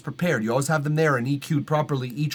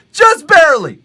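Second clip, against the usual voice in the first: higher and louder, emphatic; 13 dB louder than usual. Real, not acted. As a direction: belt it out for emphasis. Repeats with rising force.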